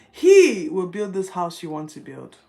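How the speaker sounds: loud and firm, every word weighted; booming God's promise. Acted. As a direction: proclaim it like God speaking. Quoting God's promise, then repeating.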